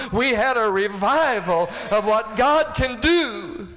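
high, loud revival preaching; preaching at full force. Acted. Preacher telling the church about his grandpa, at full force. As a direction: build the story into a shouted climax.

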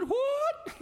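very high, loud baffled squeak; a friend caught off guard; high surprised yelp; startled what. Retold or imitated. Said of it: "He said".

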